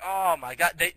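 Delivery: ordinary talk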